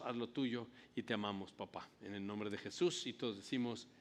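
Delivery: earnest, soft-spoken pastoral prayer; quiet prayer before the sermon. Acted. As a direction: pray over the congregation, humbly. Pastor leads opening prayer.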